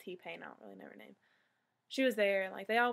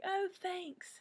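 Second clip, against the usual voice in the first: a bright, much higher "oh, thanks"; excited high thanks; 10 semitones higher than usual. Retold or imitated. Fan thanking the staffer, high and excited. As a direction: gush it like a starstruck fan.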